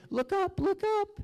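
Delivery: very high, light and sing-song; high spirit call. Retold or imitated. Spirits urging the narrator to look up, in a very high voice. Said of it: "And they say".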